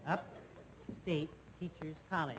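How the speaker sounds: slow, every word laid out deliberately; slow and careful. Acted. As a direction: start over and say it slowly and clearly. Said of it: "that's better".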